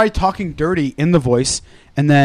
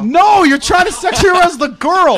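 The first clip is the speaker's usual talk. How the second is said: high, raised-voice protest; high, emphatic outburst; exasperated correction; 10 semitones higher, 3 dB louder than usual. Real, not acted. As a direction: object at a high pitch.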